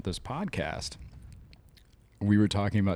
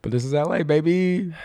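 teasing; 9 semitones higher, 5 dB louder than usual. Pretend. Teasing with L A excuse.

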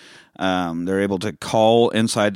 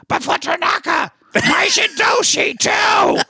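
high, strained shout, stretched out; hammed-up emotional movie speech, building to a yell; Van Damme impression; slower than usual. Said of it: "the big emotional scene in, in Bloodsport".

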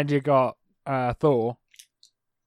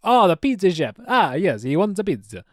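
hammed-up Italian accent; 3 semitones higher than usual. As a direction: play a cartoon Italian, jokingly. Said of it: "can do an Italian accent".

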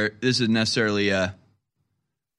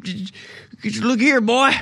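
mock Biden Southern; 9 semitones higher than usual. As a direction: parody a folksy Southern boast. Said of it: "As they say in the South".

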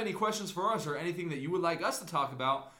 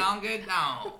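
put-on country accent; 6 semitones higher, 5 dB louder, slower than usual. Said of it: "them country accents".

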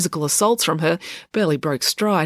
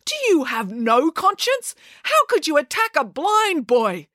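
much higher, slow outraged; teacher accusing April, slow and higher; 10 semitones higher than usual. Acted. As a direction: shame her for it. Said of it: "accused Mister Lang".

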